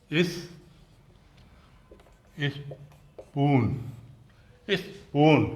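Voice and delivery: slow 'is... poon' for स्पून; leading 'is' sound. Shown as a demonstration. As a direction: break स्पून into इस and 'poon', with a pause. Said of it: "ई एस की आवाज़ है".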